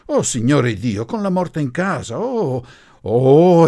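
high, loud spinsters' wail; mewling horror. A narrator's reading. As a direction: wail it out, scandalised, rising to loud "oh"s. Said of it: "echeggiarono miagolando le due zitellone per, con orrore". (miagolio inorridito di zitellone, acuto e forte)

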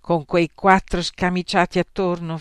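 usual speaking voice

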